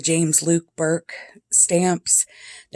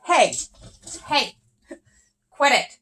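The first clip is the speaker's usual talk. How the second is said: higher sharp call across the room; yelling at cats; 7 semitones higher than usual. Real, not acted. Owner scolding fighting cats from across the room, sharp and high. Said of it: "I'm gonna yell at them".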